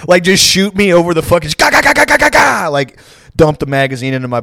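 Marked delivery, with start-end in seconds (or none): 1.6-2.7 high, loud "ga ga ga" gunfire burst; mock gunfire. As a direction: rattle off a machine gun with your mouth. Imitating gunfire at him.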